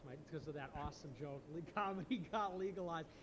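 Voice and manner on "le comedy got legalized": laughing. Real, not acted. Repeats own joke laughing.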